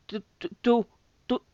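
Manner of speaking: stuttering the same word in shock; sputter, unable to go on; stammering. A narrator's reading. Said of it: "balbucía Steve".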